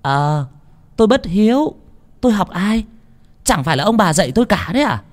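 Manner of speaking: high, fast scornful comeback; sarcastic retort. A narrator's reading. Daughter snapping back at her paralysed mother, high and scornful. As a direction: throw it back mockingly.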